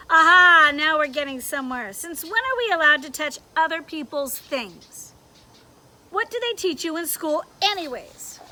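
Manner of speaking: loud triumphant scolding; pounce, then lecture him; scolding conscience. Acted. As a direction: conscience telling Leon off, loud.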